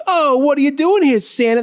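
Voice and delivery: high, loud mocking sneer; taunting. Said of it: "They're like".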